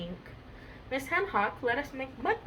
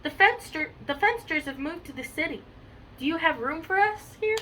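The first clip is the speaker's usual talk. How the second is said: lightly voiced cow, higher pitch; Shirley; 6 semitones higher than usual. A narrator's reading. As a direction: plead for room in a storybook read-aloud. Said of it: "Shirley spoke".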